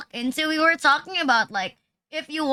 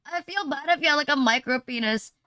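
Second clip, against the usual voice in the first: ordinary delivery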